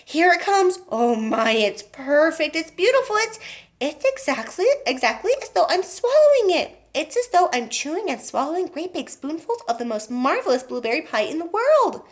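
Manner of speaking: put-on a greedy girl savouring every bite; rapturous Violet. A narrator's reading. Violet voiced while chewing.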